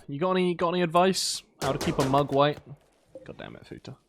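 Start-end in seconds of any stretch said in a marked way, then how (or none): 3.2-4.0 exasperated curse under the breath; whispered curse. Real, not acted. Gamer whisper-cursing his colonist Futa for missing. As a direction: breathe the curse out, almost silent.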